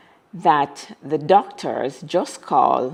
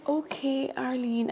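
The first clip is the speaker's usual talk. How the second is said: very soft, higher, confused; dazed quiet okay; 7 semitones higher, 13 dB quieter than usual. Retold or imitated. [name] hanging up on Arlene, soft and confused. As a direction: murmur it, lost and confused. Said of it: "So I'm like".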